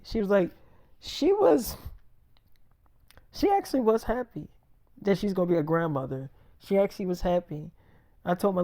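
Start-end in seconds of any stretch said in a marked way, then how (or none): 1.0-4.5 imitating girlfriend's reply. Pregnant girlfriend telling him about her mom, imitated in a high voice. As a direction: voice her answer high, then ease down.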